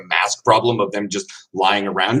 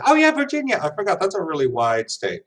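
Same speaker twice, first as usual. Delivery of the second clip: mock realization; 7 semitones higher, faster than usual. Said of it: "And I keep thinking".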